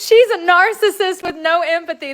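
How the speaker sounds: loud, high mocking quote through laughter; laughing disbelief. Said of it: "That's what somebody said".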